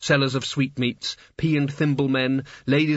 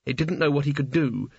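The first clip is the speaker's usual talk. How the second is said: ordinary delivery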